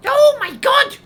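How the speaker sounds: loud, startled exclamation; a sudden loud gasp of alarm; shocked cry. Acted. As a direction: blurt it out in shock. Flo reacts in alarm.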